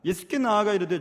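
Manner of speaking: ordinary talk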